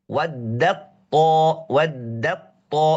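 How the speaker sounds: "waddat ta" slow, the t merged into ta; idgham demo. Shown as a demonstration. Teacher demonstrating full idgham to students, slowly. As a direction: stretch "waddat ta" so the ta is fully assimilated. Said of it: "Ta dengan ta itu mutajanisin".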